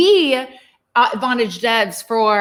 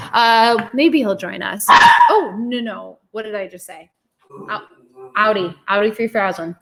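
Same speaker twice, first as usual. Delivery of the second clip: normal talking voice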